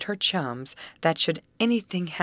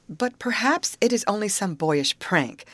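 higher-pitched hopeful suggestion; 6 semitones higher than usual. A narrator's reading. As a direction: offer comfort with a lift in the voice. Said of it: "suggested Betty hopefully".

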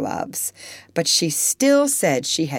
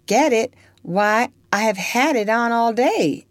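surprised; 7 semitones higher, 3 dB louder than usual. Acted. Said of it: "rather surprised".

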